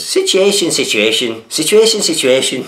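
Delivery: high-pitched mock Irish call, swooping down on each repeat; hammed-up Irish catchphrase, high and loud; Keith Lemon's Irish accent. Host imitating a TV comic calling Irish guests, mock Irish accent. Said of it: "he does some real calling of the, of the Irish guests".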